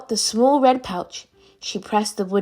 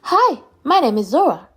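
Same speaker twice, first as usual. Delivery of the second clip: loud, swooping self-introduction; trying out a name; 6 semitones higher, 5 dB louder than usual. A narrator's reading. Eunhae tests a name at the mirror, emphatic. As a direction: announce it high with big pitch swoops.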